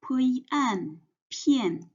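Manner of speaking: P, yi, an, then pian blended together; sounding out pinyin. Shown as a demonstration. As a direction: spell out the parts of pian, then join them. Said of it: "and one compound final an".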